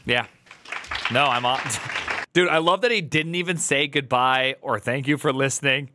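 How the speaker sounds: ordinary talk, nothing marked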